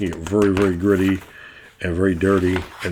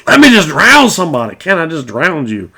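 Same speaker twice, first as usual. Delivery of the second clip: higher, louder eager plea; gleeful DM; 8 semitones higher, 7 dB louder than usual. Retold or imitated. DM pleading with players to drown a character, gleeful and eager. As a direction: beg to drown someone with mock glee. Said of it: "And I said".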